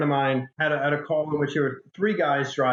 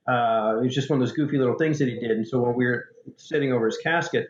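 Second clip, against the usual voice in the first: ordinary delivery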